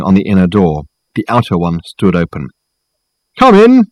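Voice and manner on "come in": high, loud call from within. A narrator's reading. Voice from inside answering a knock, calling out. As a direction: sing out to visitors at the door. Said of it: "called a voice from within".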